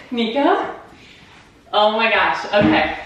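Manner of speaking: high, giddy, shaky with nerves; nervous laughing. Real, not acted. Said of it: "I'm shaking".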